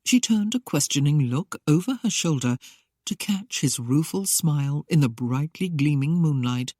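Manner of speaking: plain reading voice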